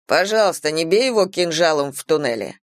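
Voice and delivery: plain reading voice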